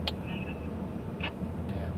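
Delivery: ordinary talk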